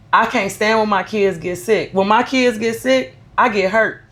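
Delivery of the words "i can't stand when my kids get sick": ordinary talk, nothing marked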